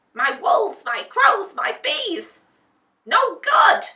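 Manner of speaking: high, loud furious witch; angry witch. A narrator's reading. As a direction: rage at each useless servant. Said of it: "she thought angrily".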